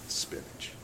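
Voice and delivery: almost whispered "Spinach."; wife quietly cutting in with "Spinach."; quiet code word. Retold or imitated. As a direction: softly drop the word "Spinach." to end the fight.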